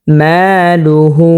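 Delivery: very slow, drawn-out মালুহু; Quran recitation. Shown as a demonstration. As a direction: stretch the ma by one alif, chanting. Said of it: "যেমন".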